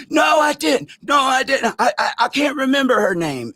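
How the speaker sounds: high, loud frantic denial; panicked denial. Retold or imitated. Narrator mimics Larry shouting denials when confronted.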